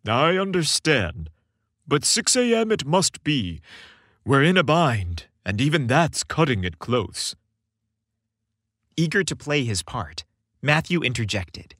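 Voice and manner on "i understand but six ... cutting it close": gentle Santa. A narrator's reading. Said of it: "eyes twinkling with mischief and love".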